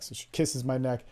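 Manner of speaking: usual speaking voice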